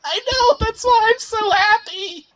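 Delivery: very high, loud creature screech; gleeful ghoul. Acted. Ghoul happy it will die, high gleeful squeal. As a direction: squeal it with manic delight.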